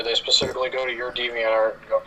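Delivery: normal talking voice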